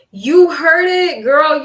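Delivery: slow loud you heard it; emphatic agreement. Real, not acted. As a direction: drag it out, loud and sure. Confirming the fire truck sound.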